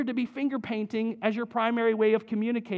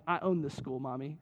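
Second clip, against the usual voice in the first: softer and lower, smug aside; sassy little girl; 6 semitones lower, 7 dB quieter than usual. Retold or imitated. Preschooler telling mommy she owns the school, soft and smug. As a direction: toss it off like you own the place. Daughter voiced bossing at preschool.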